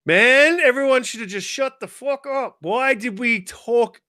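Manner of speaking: mock frustrated gamer shouting; exasperated rant. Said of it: "I was like".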